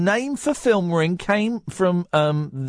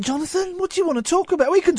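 high-pitched; 11 semitones higher, much faster than usual. Acted. Host sings out to caller.

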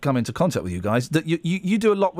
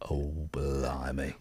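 whisper; 12 dB quieter, much slower than usual. Real, not acted. Shocked at hatching time.

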